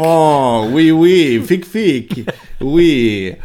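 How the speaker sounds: loud, hammed-up French chef voice; mock French chatter, loud and hammy.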